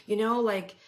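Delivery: normal talking voice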